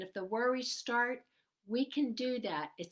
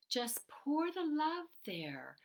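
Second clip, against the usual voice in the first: whispered calm; 5 semitones higher, slower than usual. Acted. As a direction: whisper it tenderly and slowly. Soothing guidance.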